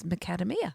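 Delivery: "Macadamia" mispronounced matter-of-factly; mum's odd pronunciation. Retold or imitated. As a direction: answer quietly, stressing "KAD" in "Macadamia". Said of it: "She says".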